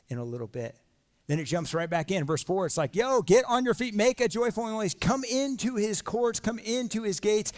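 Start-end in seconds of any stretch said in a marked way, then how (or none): 3.0-7.5 hype call. Retold or imitated. Paraphrasing psalm as hype call.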